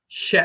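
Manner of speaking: "he" with a throaty forceful h; emphatic h. Shown as a demonstration. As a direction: give "he" a hard pushed breath. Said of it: "the H with the dot under it".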